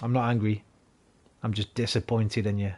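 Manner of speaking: a hint of a let-down parent; disappointed parent. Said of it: "when they go".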